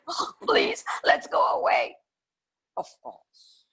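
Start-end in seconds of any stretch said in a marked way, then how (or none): 0.1-2.0 high, whiny begging; pleading fear. Acted. Scared robber begs the gang to leave. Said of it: "he said".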